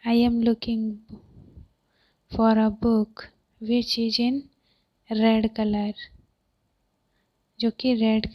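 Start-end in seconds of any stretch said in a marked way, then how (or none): 0.0-5.9 long pauses between short chunks; deliberate, broken-up teaching delivery; phrase-by-phrase repeat. Shown as a demonstration. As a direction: dictate it piece by piece to learners.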